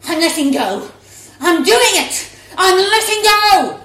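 triumphant shouts rising; excited shout building. Acted. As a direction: build to a thrilled scream. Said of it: "Ruby's mum shouted".

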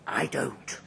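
whispered boast. Acted. Drunk passenger confiding to the rider, whispering. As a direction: drop it to a secret whisper.

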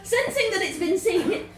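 narrating through laughter; barely holding back laughter; laughing. A narrator's reading.

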